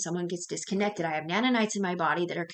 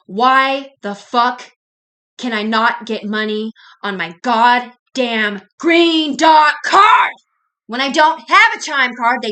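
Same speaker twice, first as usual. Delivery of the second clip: slow, loud indignant yell; furious complaint about the card, rising to a shout; angry outburst; 3 semitones higher, 11 dB louder, slower than usual. Real, not acted. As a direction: drag out the demand, then blast the last words. Angry rant about blocked card.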